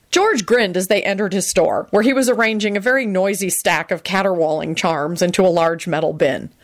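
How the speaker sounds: plain reading voice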